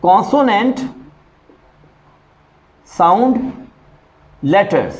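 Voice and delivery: words spaced out with long pauses; slow rule statement. Shown as a demonstration. Teacher stating the rule to students, spaced out. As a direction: let each word land before the next. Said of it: "और दा कहां बोलेंगे?".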